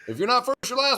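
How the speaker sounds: high-pitched movie quote impression; hammed-up dad catchphrase; Ricky Bobby's dad. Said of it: "His dad was like Ricky Bobby's dad".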